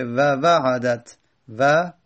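و وعدت said very slowly, each sound apart; slow syllable demo. Shown as a demonstration. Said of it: "بعد بگیم وعدت".

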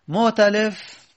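teacher sounding out مؤتلف as motalef; pronouncing mu'talif. Shown as a demonstration. Teacher pronouncing the term for students, clearly. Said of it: "از یعت-، ائتلف".

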